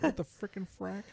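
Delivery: high, shocked outburst; shocked exclamation. Retold or imitated. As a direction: blurt it out in high surprise. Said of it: "And you're like".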